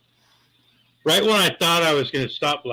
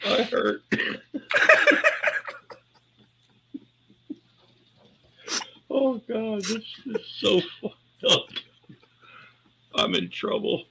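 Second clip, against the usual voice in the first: weak from laughing; 7 semitones higher, 4 dB quieter than usual. Real, not acted. Grandpa can't stop laughing.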